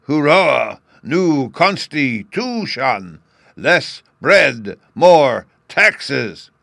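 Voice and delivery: loud, slow chanted cheer; crowd cheer. A narrator's reading. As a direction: shout slogans with the crowd, high and loud. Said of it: "they all raised a hoarse cheer".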